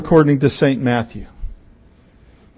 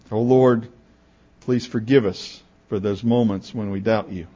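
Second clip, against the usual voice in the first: low, soft plea to God; hushed prayer; 3 semitones lower, 7 dB quieter than usual. Real, not acted. Preacher asking the Lord's forgiveness, praying softly. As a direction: lower the voice into a humble prayer. Prays mid-sermon.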